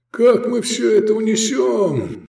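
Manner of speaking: high, drawn-out yell; high slow wail; wailing shout. A narrator's reading. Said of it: "вопил Турап".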